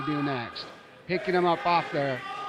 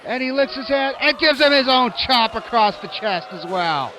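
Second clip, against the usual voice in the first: high, rising excited call; sudden burst of ringside excitement; excited play-by-play; 8 semitones higher, 5 dB louder than usual. Real, not acted.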